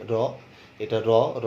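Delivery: র as plain r with tongue on palate; ordinary tongue-tip r on র; palatal r. Shown as a demonstration. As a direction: say each র without retroflex curl. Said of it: "তালুর সাথে স্পর্শ করে".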